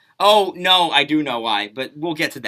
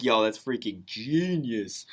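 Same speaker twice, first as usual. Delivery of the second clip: impressed; 7 semitones lower, 4 dB quieter, much slower than usual. Retold or imitated. Impressed reaction to a clever trick.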